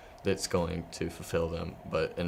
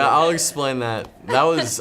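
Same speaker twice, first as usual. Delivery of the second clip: laughing; 7 semitones higher, 10 dB louder than usual. Real, not acted. Caught out by childhood nickname.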